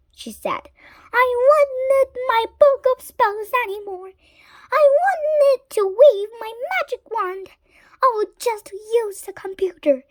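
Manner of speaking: high, fast, eager witch voice; high excited witch. A narrator's reading. Winnie planning to load spells into the computer, eager. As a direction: rush through the bright idea.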